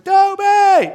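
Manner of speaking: high, loud call across the room; a far-off shout of a name; hollered name. Retold or imitated. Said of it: "being hollered".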